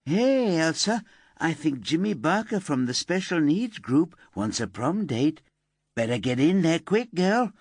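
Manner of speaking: high, sneering imitation of bullies; mocking mean girls. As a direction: taunt like a playground bully. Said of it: "Stupid girls going".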